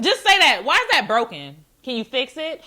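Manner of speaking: loud, high blunt demand. Retold or imitated. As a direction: bark it straight out.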